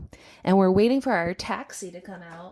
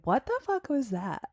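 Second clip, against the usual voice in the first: baffled; 6 semitones higher, 6 dB quieter than usual. Retold or imitated. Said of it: "I was like".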